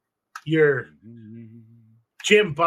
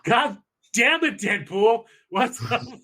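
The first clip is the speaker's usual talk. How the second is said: higher, mock-exasperated exclamation; exasperated outburst; 7 semitones higher, slower than usual. Retelling his reaction to a strange noise, higher mock outburst. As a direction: throw up your hands, pitched up. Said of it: "And I was like".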